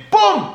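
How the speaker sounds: high, loud boom; a sudden imitated boom; mock explosion. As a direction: burst out with an explosion noise. Imitating a suicide bomb.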